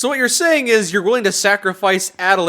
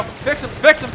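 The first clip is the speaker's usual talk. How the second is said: urgent command; 6 semitones higher than usual. Real, not acted. Repeated order in combat.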